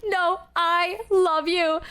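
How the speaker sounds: high, slow, stretched "I"; drunken insistence. Retold or imitated. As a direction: lean hard and long on "I". Acts out drunk insistent love.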